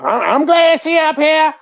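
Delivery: high-pitched mocking parrot of her line; mocking mimicry. Reacting to the woman glad Joy came, mocking mimicry.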